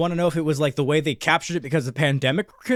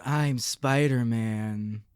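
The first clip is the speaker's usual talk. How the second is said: slow deep fake voice; 4 dB quieter, much slower than usual. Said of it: "with a weird deep fake face and going".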